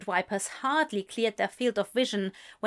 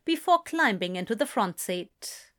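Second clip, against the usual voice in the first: ordinary delivery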